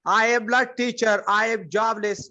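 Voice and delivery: usual speaking voice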